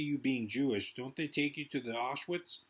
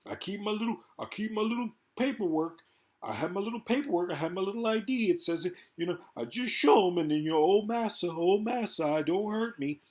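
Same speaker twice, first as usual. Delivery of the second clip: higher, pleading mock voice showing papers; mock meek immigrant; 5 semitones higher, 3 dB louder than usual. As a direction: play it small and groveling.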